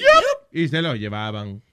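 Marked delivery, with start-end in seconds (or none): 0.0-0.5 much higher squeaky imitation; mock officer; mock cop voice.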